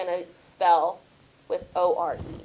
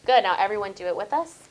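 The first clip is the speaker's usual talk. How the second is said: ordinary talk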